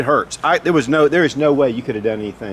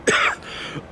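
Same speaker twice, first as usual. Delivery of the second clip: choking cough; 3 dB quieter than usual. Real, not acted. Still coughing from gas.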